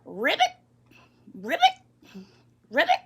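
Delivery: short chirpy ribbits, rising at the end; frog croak. Acted. Boy pretending to be a frog, playful croak. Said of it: "still acting like a frog".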